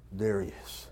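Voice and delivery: low, soft, disbelieving; whisper-quiet wonder at first sight; awed hush. Acted. The father catching sight of his returning son, low and hushed.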